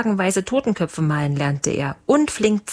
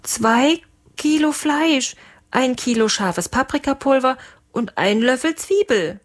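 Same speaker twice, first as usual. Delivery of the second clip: nervous cook; 7 semitones higher than usual. A narrator's reading. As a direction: list it carefully, fearing the boss. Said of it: "stotterte der Lange".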